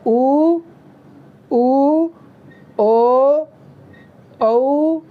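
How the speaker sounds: उ, ओ, औ said with lips rounded into a circle; गोल होंठों से उ, ओ, औ बोलिए; rounded vowel demo. Shown as a demonstration. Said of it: "तो जो वृत्तमुखी है वो है". (गोल होंठों से स्वर का प्रदर्शन)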